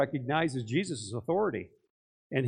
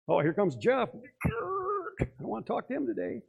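mock inner voice; 7 semitones higher than usual. Others' imagined thought.

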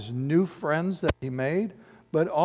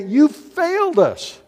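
slow, higher accusation, pressing each word; accusing crowd; 8 semitones higher, slower than usual. Retold or imitated. Angry followers blaming their leader, slow and accusing. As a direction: hurl it at him like an angry crowd. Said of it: "They say".

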